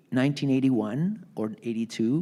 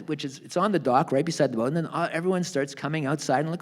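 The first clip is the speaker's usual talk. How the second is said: usual speaking voice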